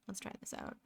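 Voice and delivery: soft unvoiced whisper. Shown as a demonstration. Streamer murmuring to herself while drawing, whispered. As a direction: breathe it out as a whisper. Repeats line, second whispered.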